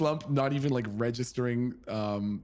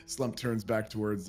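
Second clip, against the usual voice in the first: ordinary talk, nothing marked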